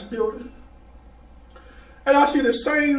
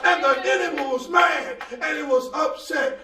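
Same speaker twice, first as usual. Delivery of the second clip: preaching at full force; 3 semitones higher than usual. Acted. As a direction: drive it home to the church. Preaching climax with congregation responses.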